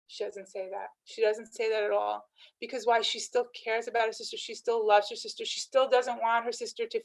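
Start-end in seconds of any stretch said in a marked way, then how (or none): none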